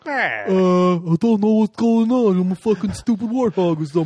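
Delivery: slow, dopey warthog henchman voice; Bebop voice. Retold or imitated. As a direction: play the dim-witted warthog goon. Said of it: "I'm way more into Bebop and Rocksteady".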